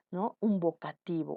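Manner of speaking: ordinary talk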